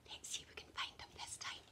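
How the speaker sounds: quick soft whisper. Acted. Teacher whispers to children before searching again. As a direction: hush the class into searching.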